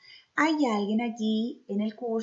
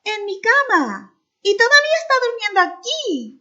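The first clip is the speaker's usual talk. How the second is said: much higher and louder, fast squeaky cry; little bear's tiny shout; 11 semitones higher, 10 dB louder, faster than usual. Acted. Little bear discovering Goldilocks in his bed, high excited shout. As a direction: shriek it like an alarmed tiny cub. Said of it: "gritó el oso con su voz chiquitita".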